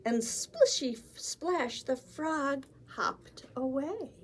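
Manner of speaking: put-on lively read-aloud for small children; animated picture-book narration; storybook reading. A narrator's reading.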